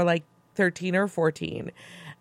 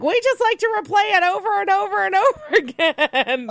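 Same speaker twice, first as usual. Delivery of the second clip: high, far-off cartoon worker voice breaking into a laugh; squeaky little-worker voice, giggling at the end; imitating the memory workers; 4 dB louder than usual. Memory workers boasting about replaying the jingle, high cartoon voice. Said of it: "and they're like".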